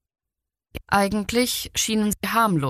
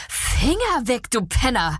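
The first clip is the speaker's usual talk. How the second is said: disgusted hiss; 5 semitones higher than usual. A narrator's reading. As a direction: spit it out with revulsion. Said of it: "Zischte Pia angeekelt".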